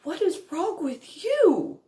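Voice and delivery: hurt and furious at once; tearful outburst. Retold or imitated. Outburst at mother.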